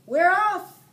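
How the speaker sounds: high, very loud cheer; crew shout. A narrator's reading. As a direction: roar it out together. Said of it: "all the crew shouted".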